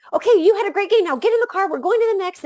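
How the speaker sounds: brisk, busy parent moving everyone along; imitating a rushed parent after a game. Parent hurrying kids after games.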